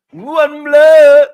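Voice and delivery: high, loud Caribbean patois exclamation, drawn out; Caribbean accent shout. Retold or imitated. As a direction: bellow it in Caribbean patois, stretching the words. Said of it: "Caribbean accent from my people".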